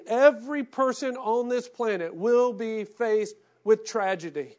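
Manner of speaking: loud preacher's build; impassioned preaching. Acted. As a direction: preach it at full voice. Preaching at full force.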